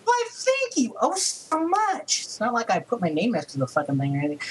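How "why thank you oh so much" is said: high, slow, syrupy mock gratitude; sarcastic thanks. Co-host hands him his own article, sarcastic high thank-you. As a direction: drip fake thanks high and drawn out. Annoyed at co-host taking credit.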